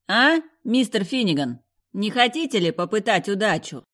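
taunting. Acted. Villain taunting captives.

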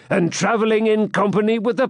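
mocking disbelief with a rising end; supercilious. A narrator's reading. Swineherd sneering at the palmer, supercilious. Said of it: "looking superciliously at him".

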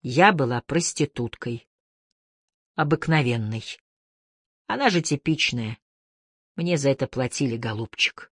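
low, quiet, emotionless confession; dry and even. A narrator's reading. Wife confessing to her husband against a tree, dry even tone. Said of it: "сказала сухо, ровно".

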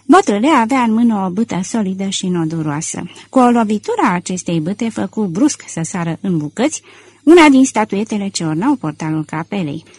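ordinary delivery

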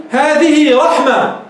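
هذه رحمة drawn out, final ة said as h; pausal ha. Shown as a demonstration. Teacher shows stopping on the word, pausal h. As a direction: stop slowly on رحمة with a breathy h ending, no t. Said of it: "تقول".

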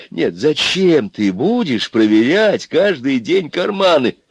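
high, very slow, insistent question; talking to a fool. A narrator's reading. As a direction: stretch it out as if for a simpleton. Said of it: "как с полным, но безвредным дураком".

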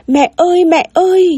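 high-pitched call for mum; child calling out. A narrator's reading. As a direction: call out for your mother like a small child. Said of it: "nó chồm dậy gọi". (giọng trẻ con cất cao gọi mẹ)

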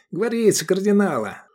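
high-pitched mocking quip; smirking. A narrator's reading. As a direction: deliver it as a wry joke. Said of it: "усмехнулся Эдик". (насмешливо, повысив голос)

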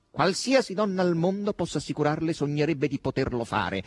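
high-pitched haughty reply. A narrator's reading. Asasello answers Margherita's question, haughty. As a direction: answer with lofty disdain. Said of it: "grugnì con un'espressione altera".

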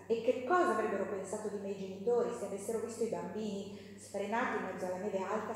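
normal talking voice

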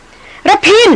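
very high, loud call of his name; alarmed cry. Acted. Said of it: "หล่อนร้องก้องออกมาอย่างลืมตัว". (ร้องเรียกก้องอย่างตกใจ)

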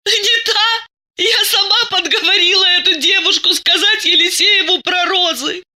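high-pitched, tearful outburst; through sobs. A narrator's reading. Masha confesses she set the girl up, sobbing. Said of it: "сквозь рыдание выдавила из себя Маша".

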